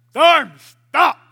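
loud, abrupt order; booming acted command; sudden command. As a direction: shout down the storm. Jesus commands the storm.

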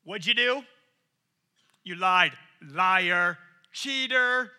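accusing label maker. Retold or imitated. Imagined God labelling sinners, loud mock accusation. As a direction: bark out the labels like charges. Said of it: "God with a big label maker going".

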